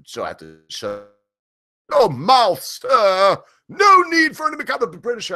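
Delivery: mock British, rising very high; a hammed-up Brit, climbing into a squeak; British accent. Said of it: "I can hear the British right now".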